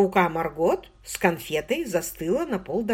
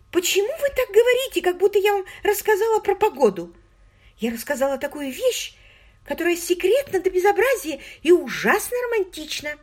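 put-on high, eager girlish voice; an excited young woman confiding; 12 semitones higher, 3 dB louder than usual. A narrator's reading. Margot voiced by the narrator.

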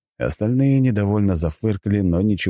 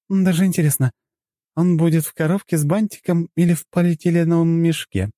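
high, playful giggly voice; Lora; 11 semitones higher, 3 dB louder than usual. A narrator's reading. Companion jokes about the wrapped body, playfully giggly. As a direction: quip teasingly in a very high pitch. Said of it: "хихикнула Лора".

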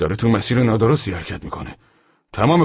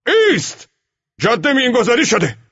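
very high, full shouted warning; shouting; 9 dB louder than usual. A narrator's reading. Jean warning a driver off the minefield, shouting. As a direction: yell for the driver to halt. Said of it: "جین دست تکان داد و فریاد کشید".

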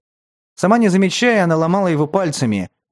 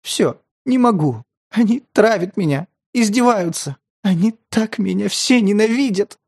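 sobbing; 6 semitones higher than usual. A narrator's reading. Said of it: "сквозь всхлипы стали прорываться слова".

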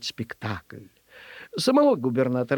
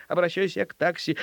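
ordinary delivery, nothing marked

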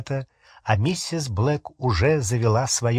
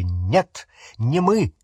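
higher-pitched, urgent denial; mild cry; 5 semitones higher than usual. A narrator's reading. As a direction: protest urgently, voice lifted. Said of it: "крикнул он". (сдержанный крик протеста)

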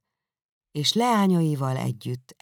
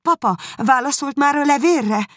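high, louder urgent asking; eager question; 13 semitones higher, 5 dB louder than usual. A narrator's reading. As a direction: press for the answer, voice up high. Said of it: "kérdezte Elizabeth". (izgatott, magas hangú kérdés)

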